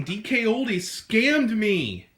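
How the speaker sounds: high, slow wail of outrage; melodramatic, wailing scam-victim rant; overacted anger. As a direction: drag out each line's last word in hammy fury. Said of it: "Do you hear me acting?".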